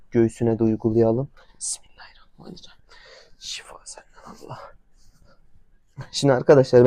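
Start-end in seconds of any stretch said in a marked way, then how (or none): 1.6-4.6 barely audible voiceless whisper; whispered prayer. Real, not acted. Owner praying for healing while treating horse, whispering. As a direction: pray under your breath, very hushed. Private prayer before applying the spirit.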